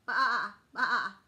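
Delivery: loud bleating baa; playful sheep baa for kids. Pretend. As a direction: bleat it like a lamb. Lamb sound in animal book.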